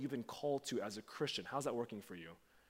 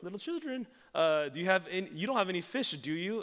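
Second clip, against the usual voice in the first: higher-pitched, louder playful tease; teasing address; 6 semitones higher, 5 dB louder, faster than usual. Acted. Jesus calls to disciples from the shore, teasing. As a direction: tease them like little kids, pitched up. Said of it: "it actually reads like this".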